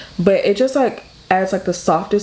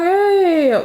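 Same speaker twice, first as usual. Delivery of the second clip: carefree hey; 11 semitones higher than usual. Retold or imitated. Kid brushing off mom at mall.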